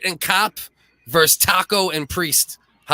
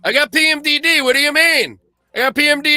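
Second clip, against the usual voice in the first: mock hysterical woman; 10 semitones higher than usual. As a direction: wail the excuse in a shrill put-on voice. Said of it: "Be like".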